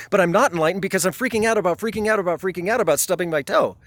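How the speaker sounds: rushed, escalating worried ramble; anxious rambling seeker. Retold or imitated. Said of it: "They're like".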